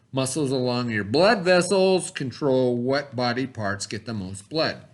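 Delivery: ordinary talk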